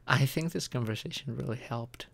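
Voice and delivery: normal talking voice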